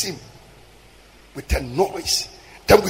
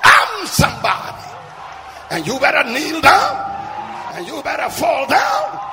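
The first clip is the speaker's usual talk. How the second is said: thundering divine command; 6 semitones higher than usual. Acted. Preacher voices God telling people to kneel down, high commanding cry. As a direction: declare it as God demanding worship.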